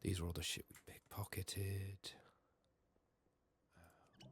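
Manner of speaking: usual speaking voice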